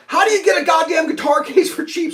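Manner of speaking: retelling his own frustrated question; exasperated asking. Retold or imitated. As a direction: throw up your hands about it. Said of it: "So I'm like".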